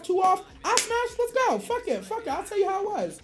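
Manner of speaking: normal talking voice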